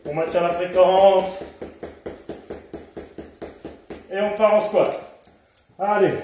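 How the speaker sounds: loud, pushing workout cues; coach calling out. Real, not acted. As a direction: call it out to keep them moving. Coach calling out during exercise.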